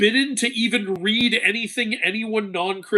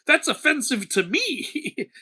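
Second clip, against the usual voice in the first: higher, slower, hammed-up indignation; mock offended Aphrodite; 6 semitones higher, slower than usual. Aphrodite scolding a mortal who won't date, mock offended. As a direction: play the goddess taking it personally, slowly. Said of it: "And Aphrodite's like".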